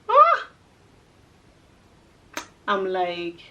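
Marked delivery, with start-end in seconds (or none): 0.1-0.5 loud, high "ah"; baffled cry. Retold or imitated. Traveler baffled by the officer's question, high loud cry. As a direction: let out a short, high yelp of confusion.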